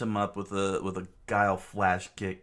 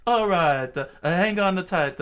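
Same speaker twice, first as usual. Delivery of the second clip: higher, louder eager shout; loud character call; 11 semitones higher, 10 dB louder than usual. Acted. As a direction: call out to a friend.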